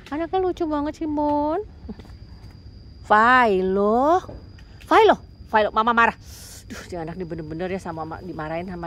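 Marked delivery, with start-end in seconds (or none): 0.1-1.6 much higher cooing to a pet. Real, not acted. Owner coos over the kitten to Abon, higher baby talk. 3.0-6.1 loud drawn-out call across the house; calling out to the cat. Real, not acted.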